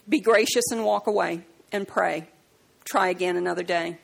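ordinary talk, nothing marked

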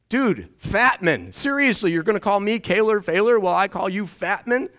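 high, disbelieving comeback; seriously, are you kidding me; incredulous retort. Retold or imitated. Retorting to bully, high pitch.

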